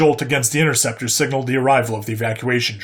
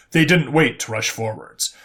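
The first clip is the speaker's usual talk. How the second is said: ordinary delivery, nothing marked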